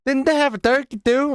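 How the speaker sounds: high-pitched mocking Southern accent. Host mimics the podcaster's turkey question, mock Southern accent. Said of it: "so sick of the Southern accent".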